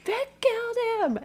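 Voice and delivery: a lightly hammed-up panicked accusation; freaking out. Melinda freaking out at the cops, a hammed-up high scream. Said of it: "She's like, ... freaks out".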